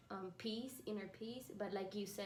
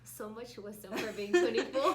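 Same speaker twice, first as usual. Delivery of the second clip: loud, laughing amazement; 2 semitones higher, 11 dB louder than usual. Real, not acted. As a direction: say it while breaking into real laughter. Teasing praise breaking into laughter.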